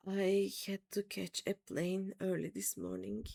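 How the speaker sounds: ordinary delivery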